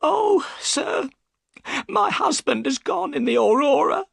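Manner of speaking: very high, tearful woman; high-pitched crying voice. A narrator's reading. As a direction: wail it as a wife about to burst into tears. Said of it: "burst into tears".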